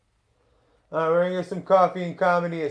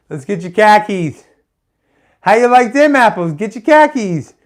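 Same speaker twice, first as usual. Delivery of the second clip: hammed-up Boston accent; 3 semitones higher, 7 dB louder than usual. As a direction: bark it like a rowdy Boston fan. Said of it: "I suck at 'em".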